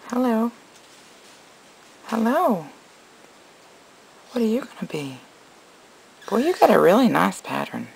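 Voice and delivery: high-pitched cooing hello. Real, not acted. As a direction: greet a tiny pet sweetly, high and lilting. Greeting a baby snake.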